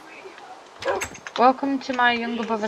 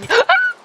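high, loud shocked gasp; startled high gasp; sharp gasp; 10 dB louder than usual. Real, not acted. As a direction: gasp in sudden alarm. Sudden shock in game.

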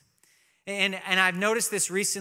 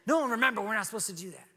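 bossy little brother; 4 semitones higher, faster than usual. Retold or imitated. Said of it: "Roman's like".

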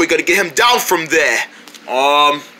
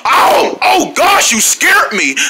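startled; 8 semitones higher, 3 dB louder, slower than usual. Acted. Startled by a scary guy.

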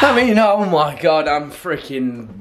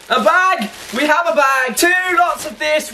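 excited; 12 semitones higher than usual. Real, not acted. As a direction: gush over each item. Gushing over the goodies.

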